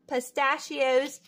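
ordinary delivery, nothing marked

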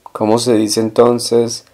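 ordinary talk

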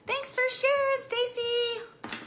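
very high, loud mock thanks; sarcastic squeak. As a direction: tease her with a squeaky fake thank-you. Sarcastic aside to daughter.

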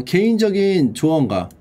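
ordinary delivery

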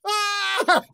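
high yelp of fright; dad fleeing bear lets out a high yelp; mock scream. Short high scared cry.

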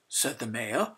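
ordinary delivery, nothing marked

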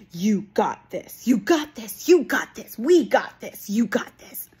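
hammed-up repeated encouragement; mock cheer. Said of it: "how I hate that phrase".

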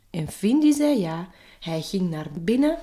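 plain reading voice

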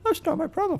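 squeaky high-pitched mockery; mocking high voice. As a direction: parrot it back in a silly high voice. Mocking the rude driver.

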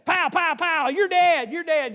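put-on high shouted child's gunfire play; kid playing guns. A boy playing cops and robbers shouts at a friend, high and loud. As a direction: yell it like a kid firing a stick gun. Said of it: "We'd say".

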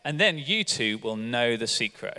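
normal talking voice

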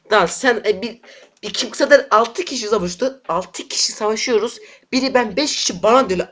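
high, loud rage, near screaming; real outraged shouting; furious yelling. Real, not acted. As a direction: scream at the whole enemy team. Raging, ganged up on.

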